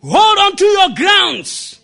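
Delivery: loud, high, slow exhortation; preaching. Real, not acted. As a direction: charge the congregation at full force. Preaches the moral at full force.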